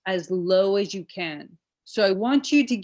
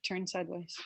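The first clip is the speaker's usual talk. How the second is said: quiet aside; 3 semitones lower, 16 dB quieter than usual. Real, not acted. Quiet instruction to the demonstrator.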